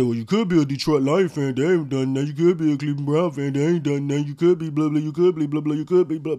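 a mocking fan voice reeling off excuses; mocking other fans. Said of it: "Some people say".

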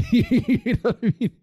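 high laugh through the words. Real, not acted. Laughing at own joke.

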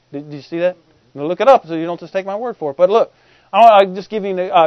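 ordinary delivery

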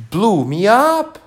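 high, loud, slow breathless queen; out-of-breath queen voice; panting queen. A narrator's reading. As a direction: gasp it out after being flung through the air. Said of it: "panted the queen".

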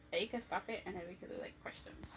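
quick hushed aside; whisper. Real, not acted. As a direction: whisper it fast off the mic. Aside about stopping recording.

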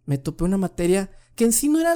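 normal talking voice

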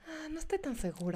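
small, unsure mock voice; insecure hesitant voice. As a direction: say it like you doubt yourself. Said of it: "todas como que". (voz insegura y dudosa)

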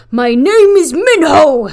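loud, high, drawn-out hammed-up shout; belted proclamation. Quoting a beloved idol song line, belted out. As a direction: belt it out as a signature line. Quotes rival song's signature line.

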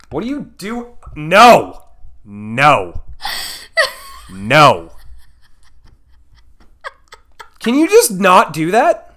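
fed up with being filmed; annoyed. Real, not acted.

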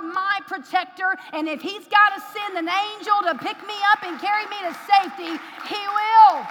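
loud, slow, high preacher's cry; fiery preaching. Real, not acted. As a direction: proclaim it with all your force. Preacher builds to full force.

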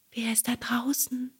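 unvoiced, soft and thin; fine whisper. Hidden cricket calls through the door, thin whisper. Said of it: "rief eine feine Stimme".

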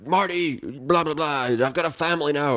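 channel an excitable Doc Brown; Doc Brown impression. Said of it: "and he's like".

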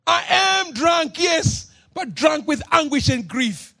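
anguished plea. Acted. Hannah answers Eli in anguish.

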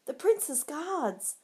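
high, slow, urgent call; a lookout calling out to warn the Capulets. A narrator's reading. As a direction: alarm cry to the fleeing gang. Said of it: "A voice called out".

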